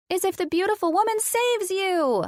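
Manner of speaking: put-on high, excited child's squeal; squealing girl. Addison squealing at Colin's game. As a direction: squeal it with delight. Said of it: "Addison squealed".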